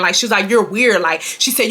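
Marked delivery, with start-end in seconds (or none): none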